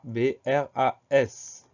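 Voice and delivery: slow letter-by-letter dictation of "B R A S"; measured spelling-teacher delivery, letter by letter; spelled out slowly. Shown as a demonstration. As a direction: say "Bras", then space out each letter.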